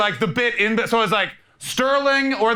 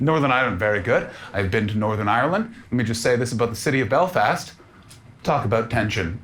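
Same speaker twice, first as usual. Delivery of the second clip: much lower, solemn aside; lowered mock-serious voice about Belfast; mock grave; 9 semitones lower than usual. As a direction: drop the voice low like a dark warning.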